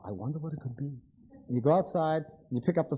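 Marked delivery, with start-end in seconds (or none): 0.0-1.0 musing to himself. Retold or imitated.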